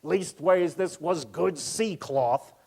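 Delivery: put-on slow, drawn-out Bristol accent; a lightly done Bristol sailor accent, slowly; thick Bristol accent. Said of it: "always spoken with a thick Bristol accent".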